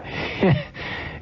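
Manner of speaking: airy high-pitched cackle of delight; high breathless laugh. Real, not acted. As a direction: burst into a breathless giggle. Host cracks up at caller.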